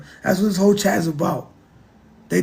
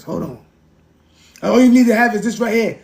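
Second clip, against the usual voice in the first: ordinary talk, nothing marked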